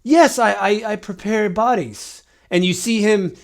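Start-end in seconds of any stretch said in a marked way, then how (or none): 0.0-2.2 a confused ancient, pitched up and fumbling; flustered mummy. Acted. Mummy answers player.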